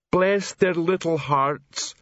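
slowly, a touch sugary troll; sweet troll voice. A narrator's reading. Clinkerbell speaks.

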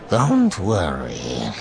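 slow, low alien voice; low-pitched, drawn-out creature reassurance. A narrator's reading. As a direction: drag it out low, as an amused creature. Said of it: "she said in a low voice".

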